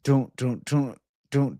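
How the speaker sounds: ordinary talk, nothing marked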